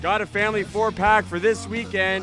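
cheesy drive-time radio host; radio DJ patter. Acted. Radio DJ show intro.

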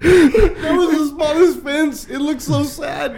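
slow, high words squeezed through laughter; laughing. Real, not acted. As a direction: crack up while you say it. Laughing at the broken fence.

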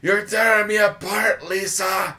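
high, loud melodramatic outburst; hammed-up movie line. Co-host quotes The Room on cue, hammed-up anguished shout. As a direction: wail it like a terribly overacting film star.

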